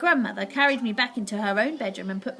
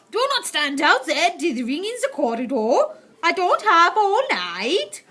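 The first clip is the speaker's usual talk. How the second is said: higher, louder, slow at the end; impatient witch; 7 semitones higher, 8 dB louder than usual. A narrator's reading. Witch scolding dithering witches, loud and impatient. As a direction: order them in impatiently.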